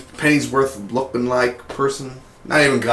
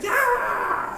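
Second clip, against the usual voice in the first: mock laser shriek; 5 dB quieter than usual. Laser beam fight.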